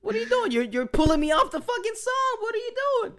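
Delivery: high frustrated whine. Retold or imitated. Voicing frustrated drummer.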